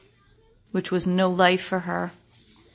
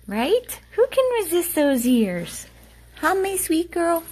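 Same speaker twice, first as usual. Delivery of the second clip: higher, louder sing-song coo to the dog; 10 semitones higher, 4 dB louder than usual. Real, not acted. Owner gushing at her dog in a high coo.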